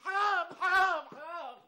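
husband sobbing at the arguing family; crying plea. Acted. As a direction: beg them to stop through sobs. Husband breaks down pleading.

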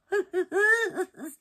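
high, gleeful real laugh; elated laugh. Real, not acted. Crafter laughs as the basket works, elated. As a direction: laugh with relief and delight.

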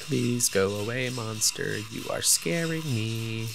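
slow, hushed pleading to the monster; mock scared plea. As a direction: beg it to leave, playfully frightened.

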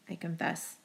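ordinary delivery

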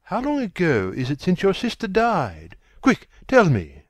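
high, pressing, desperate question; urgent demand. A narrator's reading. Desperate question before being shot.

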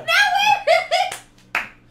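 loud, high squeal with laughter; real astonished shriek, laughing; thrilled disbelief. Real, not acted. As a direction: burst out delighted and incredulous. Reacts to second date news.

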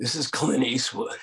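lightly voiced Clint Eastwood; bad Clint Eastwood. Clint Eastwood introducing himself on the phone, barely voiced. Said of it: "I don't do a very good Clint Eastwood".

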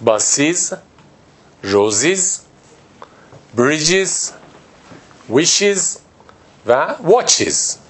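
باسیز, روزیز, بریجز, ویشیز, واچیز each with a clear iz ending; drilled list. Shown as a demonstration. Teacher repeats the plurals for the class. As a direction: run the list with rising tune, iz on every word. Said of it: "یه بار دیگه".

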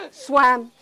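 ordinary delivery

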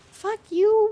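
high, loud snap at the enemy; angry outburst. Real, not acted. Gamer yelling at an annoying fly enemy, angry. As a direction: spit it at the pest.

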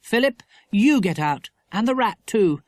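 mild parental exasperation; firm mother. A narrator's reading. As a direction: order the boy out firmly. Mother orders son out.

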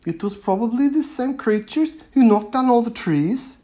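put-on a girl pressing her point; Ebba's voice. A narrator's reading. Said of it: "Ebba said".

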